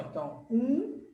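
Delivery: ordinary talk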